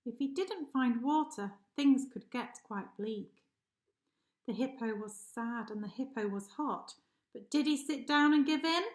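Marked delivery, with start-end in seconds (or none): none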